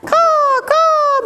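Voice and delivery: very high drawn-out caws; crow caw. Pretend. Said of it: "the last one says".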